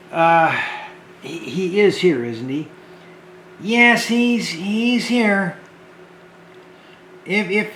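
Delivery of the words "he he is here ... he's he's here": ordinary delivery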